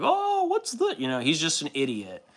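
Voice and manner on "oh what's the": very high, clueless dad voice; dumb dad voice. Retold or imitated. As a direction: squeak it out like a dopey dad. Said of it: "the dumb dad who's like".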